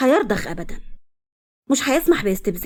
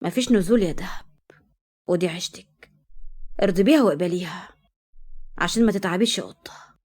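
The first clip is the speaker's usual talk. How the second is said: cold; 4 semitones lower than usual. Acted. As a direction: lay down the rule coldly, barely raising it. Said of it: "وقال لها ببرود".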